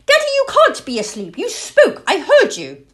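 put-on high, loud squeaky-child pestering; insistent owlet. A narrator's reading. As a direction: pester Dad loudly in a high little voice. Young owlet pestering sleeping father.

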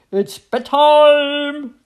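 high, loud, long drawn-out call; trumpeting mummy elephant. A narrator's reading. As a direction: trumpet it out, stretching the last word. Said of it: "trumpeted Mummy Elephant".

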